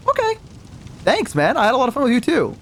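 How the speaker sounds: high, squeaky-polite brush-off; acting out uneasy niceness; awkward politeness. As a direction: pitch it way up to dodge her. Awkwardly escaping an eager date.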